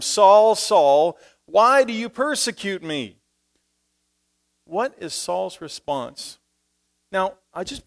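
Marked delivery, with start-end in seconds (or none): 0.0-3.2 loud, higher calling voice; voice from heaven. Acted. Heavenly voice calling to Saul, loud and high. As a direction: boom it like a voice from the sky. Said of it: "heard a voice say to him".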